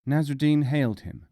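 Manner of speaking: usual speaking voice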